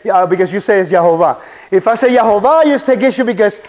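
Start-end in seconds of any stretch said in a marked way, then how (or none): none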